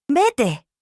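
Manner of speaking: high-pitched, mildly shouted dismissal; shouted order. A narrator's reading. Anguished woman sends the girl out of her room, mild shout. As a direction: drive her out with a restrained, high cry. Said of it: "gritó".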